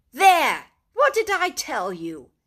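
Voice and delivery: loud, crowing cry; triumphant. A narrator's reading. Rat shows Mole the doormat in triumph. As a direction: gloat over the find. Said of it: "exclaimed the rat in great triumph".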